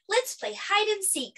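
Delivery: high-pitched playful suggestion; eager children. Acted. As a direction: propose it like excited kids. Children propose a game.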